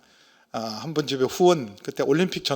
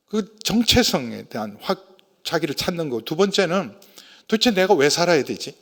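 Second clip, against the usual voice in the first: usual speaking voice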